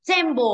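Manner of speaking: temple with a strong TEM; stress on first syllable. Shown as a demonstration. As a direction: hit TEM in temple, light ending. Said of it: "âm một và tem".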